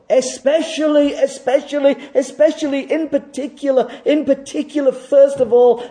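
fervent preacher's call; impassioned preaching. Acted. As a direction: proclaim it like a command from the pulpit. Preaching at full force.

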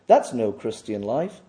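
ordinary talk

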